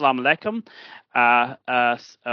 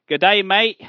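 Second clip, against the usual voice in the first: raised, louder stab at an Australian accent; hammed-up Aussie greeting that misses; bad Australian accent; 5 semitones higher, 5 dB louder than usual. Shown as a demonstration. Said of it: "that's a really bad accent".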